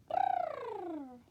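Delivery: long high teeth-chattering brrr; high shivering brrr. Acted.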